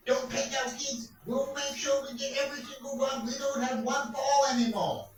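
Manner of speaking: high, hammed-up evil ranting; Satan voice. Comedian mocking Satan, high and hammed-up. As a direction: play the devil raging about stolen kids. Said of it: "says the Satan".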